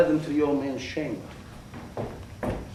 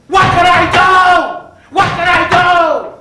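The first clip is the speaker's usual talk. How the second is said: desperate cry; 6 semitones higher than usual. A narrator's reading. Murderer trapped before the officers, desperate cry. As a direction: cry it out in helpless panic. Narrator at his wits' end.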